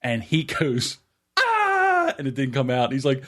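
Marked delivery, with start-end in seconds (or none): 1.4-2.2 high mock scream; imitated pain scream. Father as the nail is yanked, high cry of pain. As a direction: let out one high acted cry.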